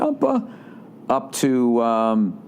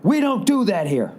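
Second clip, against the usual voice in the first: much higher, imitated airline clerk's rebuke; scolding counter lady; 11 semitones higher than usual. Airline lady putting her hand out to passenger, mock scolding. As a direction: cut him off like an indignant clerk. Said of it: "she just goes".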